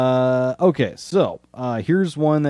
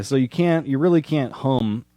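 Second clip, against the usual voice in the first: ordinary talk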